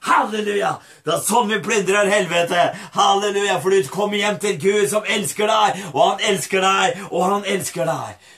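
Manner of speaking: high, loud revival shout with laughter; exultant, full-voiced praise; jubilant preaching. Real, not acted. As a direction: celebrate it like a victory.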